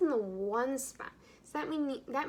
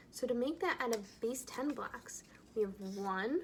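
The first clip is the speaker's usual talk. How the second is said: whispering. Real, not acted. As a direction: whisper it while counting blocks. Whispered while building blocks.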